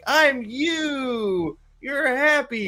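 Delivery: high, sing-out cartoon voice; Joy's cartoon voice. Joy introducing herself to Riley, high cheery cartoon voice. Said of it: "And it's like".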